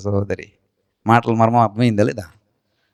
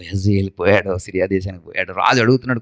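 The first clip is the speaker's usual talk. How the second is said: normal talking voice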